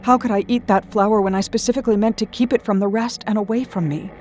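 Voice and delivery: ordinary delivery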